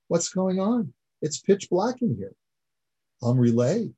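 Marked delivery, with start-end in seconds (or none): 0.1-2.4 sharply higher, puzzled outcry; startled protest. Retold or imitated. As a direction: ask in sudden confusion, voice jumping up. Yaakov protests in the dark.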